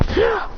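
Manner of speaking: short high-pitched yelp; acted stab cry.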